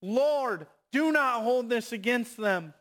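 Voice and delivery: impassioned acted shout to the Lord; loud cry. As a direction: cry it out so they all hear. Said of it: "he, he yells loudly".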